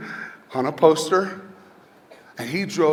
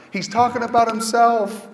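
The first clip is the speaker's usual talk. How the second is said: mock child yell; 8 semitones higher, 4 dB louder than usual. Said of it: "she yelled out into the entire crowd".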